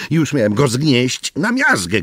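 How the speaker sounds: ordinary delivery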